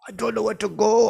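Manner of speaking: high, hollered cabbie bit; loud, high-pitched cabbie voice; New York cabbie character. Retold or imitated. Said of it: "I'm the New York cabbie".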